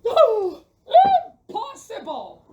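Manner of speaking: a loud, high laughing oh, then impossible; laughing refusal. Acted. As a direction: laugh the idea away. Knight laughs off the request.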